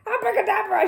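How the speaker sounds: high, loud angry shout of the magic word; furious shout. Acted. Mister Curry shouting at Paddington in rage. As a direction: bellow it in a rage. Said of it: "shouted Mister Curry".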